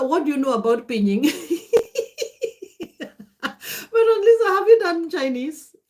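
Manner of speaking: playful teasing through laughter; giggling at a friend's surprise Mandarin; laughing tease. Real, not acted.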